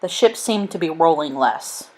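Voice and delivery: ordinary delivery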